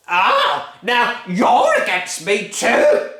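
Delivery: bully the whole family; furious Grandma. Acted. Grandma demanding her breakfast, loud bullying bellow. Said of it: "shouted Grandma".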